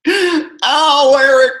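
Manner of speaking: mock pained outcry. Mock hurt by the insult.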